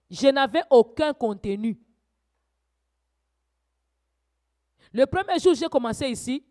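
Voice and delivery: loud, high fervent preaching; preaching at full force. Acted. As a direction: rouse the congregation, voice climbing. Preaching rises again.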